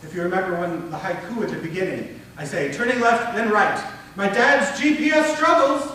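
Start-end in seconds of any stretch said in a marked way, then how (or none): 2.7-5.9 raised, loud, tense anticipation; rising anticipation. Shown as a demonstration. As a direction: build up high and loud, slowing on the last phrase. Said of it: "my voice goes up".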